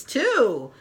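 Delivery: ordinary delivery, nothing marked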